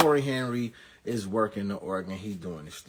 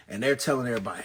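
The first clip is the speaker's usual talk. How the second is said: ordinary talk, nothing marked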